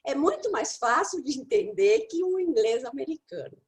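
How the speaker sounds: normal talking voice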